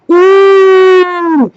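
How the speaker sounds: long, high, loud fuming "ooh"; angry "ooh". Retold or imitated. Woman retelling the moment she got madder, fuming ooh. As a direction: let out a drawn-out high ooh of rising anger.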